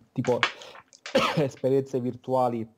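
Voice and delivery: coughing mid-phrase; coughing fit. Real, not acted. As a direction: voice catching on a cough. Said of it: "è venuta la tosse".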